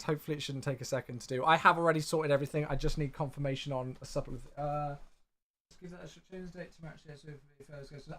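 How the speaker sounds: half-audible muttering aside; fast mumble. Real, not acted. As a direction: read under the breath while working. Mumbling while doing admin.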